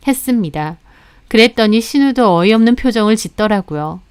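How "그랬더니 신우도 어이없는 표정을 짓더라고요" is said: ordinary delivery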